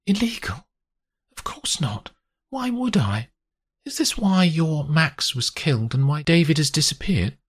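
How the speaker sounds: high, indignant character voice; protesting woman. Acted. Grace to Olivia on illegal dealings, high protest. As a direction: protest innocence, then question in high voice.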